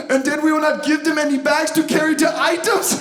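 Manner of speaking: put-on Swedish accent. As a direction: mimic a Swedish planner in a Swedish accent. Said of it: "incredibly accurate Swedish impersonation".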